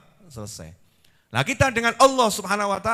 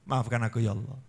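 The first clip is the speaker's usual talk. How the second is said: ordinary delivery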